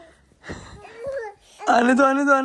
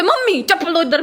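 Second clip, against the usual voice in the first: higher-pitched mock angry demand; retold anger; 6 semitones higher than usual. Woman retelling her morning anger at mum, mock angry higher pitch. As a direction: replay the cranky demand for slippers, lightly acted. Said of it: "मैं भी पूरे गुस्से से".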